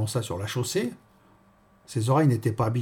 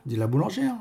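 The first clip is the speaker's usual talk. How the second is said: plain reading voice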